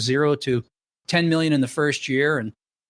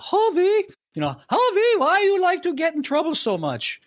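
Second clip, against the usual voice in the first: put-on mother's Hungarian accent, much higher; imitating his Hungarian mother, pitched way up. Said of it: "okay, with a Hungarian accent".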